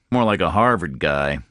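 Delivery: ordinary delivery, nothing marked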